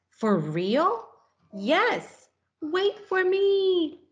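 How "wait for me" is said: calling out. Acted. Caterpillar calling after departing butterfly.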